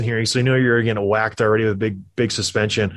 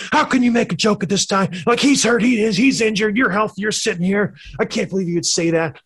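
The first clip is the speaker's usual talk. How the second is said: angry outburst; 7 semitones higher than usual. Retold or imitated. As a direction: blow up at the player in disbelief. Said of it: "And he just lost it".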